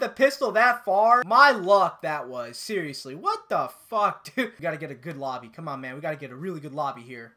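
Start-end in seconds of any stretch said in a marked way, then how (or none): none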